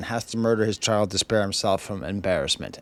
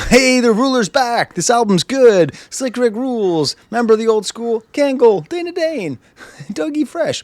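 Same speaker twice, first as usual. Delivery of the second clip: much higher and louder, gushing; hammed-up cheerleading for the comeback; playfully overexcited fan reeling off names; mock hyped fan; 10 semitones higher, 5 dB louder than usual. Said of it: "it'd be a lot easier for me to say".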